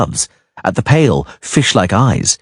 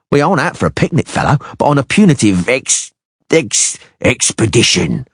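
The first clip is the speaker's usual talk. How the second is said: lightly voiced sneering mercenary, a touch higher; a hint of a leering thug; beady-eyed mercenary; 8 semitones higher than usual. A narrator's reading. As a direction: sneer it out as a crude sellsword.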